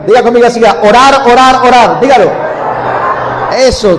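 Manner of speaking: loud call-and-response chant; loud, emphatic exhortation; rousing preaching. Real, not acted. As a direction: drive the congregation to repeat it. Leads congregation in loud call-and-response.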